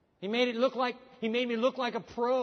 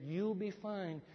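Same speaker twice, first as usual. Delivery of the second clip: normal talking voice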